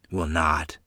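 ordinary delivery, nothing marked

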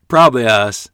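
amused, with a light chuckle; chuckling. Acted. Boy joking to his friend, chuckling. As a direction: chuckle it out, pleased with the prank. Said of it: "I chuckled".

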